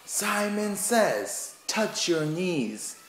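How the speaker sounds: high, slow, drawn-out classroom call; teacher voice for Simon. Shown as a demonstration. Teacher calling Simon Says to the kids slowly. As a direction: announce it slowly like a game leader. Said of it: "one more time".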